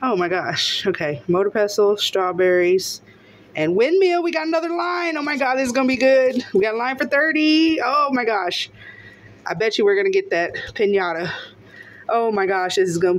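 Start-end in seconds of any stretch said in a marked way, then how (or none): 3.8-8.7 high-pitched, thrilled run-on; real excitement. Real, not acted. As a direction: voice jumps up as the win is spotted. Spots another winning line.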